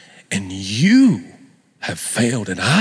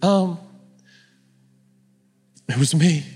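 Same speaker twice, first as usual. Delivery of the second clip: hesitant, higher-pitched admission; timid confession; 5 semitones higher than usual. Acted. Woman answering Jesus's plea, timidly confessing. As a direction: own up timidly after a long pause.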